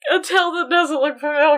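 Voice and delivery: laughing through the words; high, loud repeat breaking into laughter; amused echo. Real, not acted. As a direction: repeat it back, cracking up. Co-host echoes the phrase, amused.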